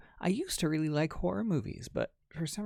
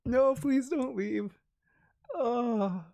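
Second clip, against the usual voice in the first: slow, higher, louder plea, half crying with laughter; laughing plea; 9 semitones higher, 6 dB louder, much slower than usual. Real, not acted. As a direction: beg them to stay while laughing so hard it nearly turns to tears. Begging viewer while laughing.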